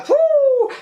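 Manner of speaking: a sudden high, loud burst of delight; a real excited yelp of enthusiasm; excited exclamation. Real, not acted. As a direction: blurt it out, thrilled. Raving about horror films.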